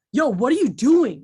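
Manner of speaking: a sharp, loud "what are you doing"; strong tone. Retold or imitated. Coworker calling out a colleague, in a firm, loud tone. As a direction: call it out firmly without quite yelling. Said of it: "it was a strong tone".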